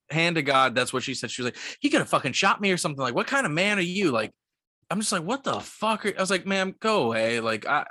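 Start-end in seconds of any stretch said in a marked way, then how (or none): 1.8-4.1 mildly imitated indignant guest, raised pitch; quick, high retold scolding; outraged reproach. Guest's reproach to the clerk repeated for a listener, mildly outraged. 5.2-7.4 high, mildly exasperated retold reaction; exasperated disbelief. Clerk dismissing the accusing guest, mildly exasperated.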